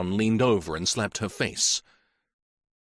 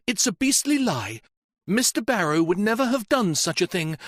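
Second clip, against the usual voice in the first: put-on higher, indignant young woman defending him; blazing denial; 9 semitones higher than usual. Janet to the inspector, defending Barrow in fury. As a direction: flare up, calling the accusation a lie. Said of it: "Then her eyes blazed".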